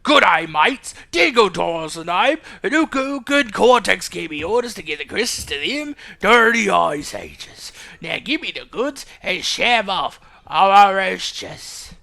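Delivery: slow, hammed-up Aussie villain voice; Dingo Dio. Henchman with orders from Cortex, menacing Aussie voice. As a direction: draw out a threat in mock Australian. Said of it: "when I do the Dingo Dio voice".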